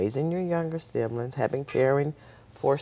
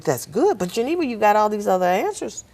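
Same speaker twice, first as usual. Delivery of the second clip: puzzled instructor; 9 semitones higher, 7 dB louder than usual. Retold or imitated. Instructors puzzled by her test.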